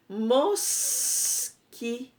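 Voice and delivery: "mosquito" stretched very slowly, syllable by syllable. Shown as a demonstration. Teacher showing pupils each syllable of "mosquito", very slowly. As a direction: pull "mosquito" apart into mos-qui-to. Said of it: "Ó".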